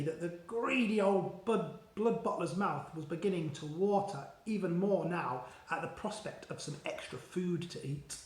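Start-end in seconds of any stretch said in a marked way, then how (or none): none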